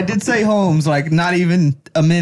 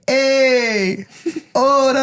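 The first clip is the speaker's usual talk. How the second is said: put-on high, stretched Mexican-accented call; 8 semitones higher, much slower than usual. Mexican across the street greets him, Mexican accent. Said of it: "I love doing Mexican accents".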